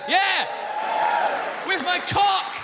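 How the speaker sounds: high, loud triumphant boast; mock macho shout. Comic boasting he got his wife pregnant, mock triumphant shout. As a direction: yell it out like a hammed-up victory cry.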